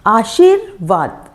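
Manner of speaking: आशीर्वाद stretched very slowly, र heard right after शा; slow teaching demo of आशीर्वाद. Shown as a demonstration. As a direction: draw out आशीर्वाद so the र follows शा. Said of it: "शा के बाद ही वो उसकी ध्वनि आती है".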